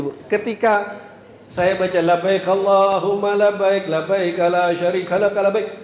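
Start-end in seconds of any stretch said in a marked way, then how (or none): 2.0-5.7 slow, steady devotional chant; heartfelt talbiyah recitation. Retold or imitated. Pilgrim of earlier years reciting talbiyah, slow heartfelt chant. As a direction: recite slowly on an even tone. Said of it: "saya berasa banget nih".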